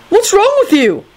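very high, appalled scolding; heated. A narrator's reading. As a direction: demand it in high outrage. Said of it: "Neville said heatedly".

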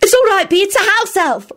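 high, hasty reassurance; high-pitched anxious outburst; blurted out. A narrator's reading. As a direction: blurt it before thinking. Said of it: "Rosie blurted out".

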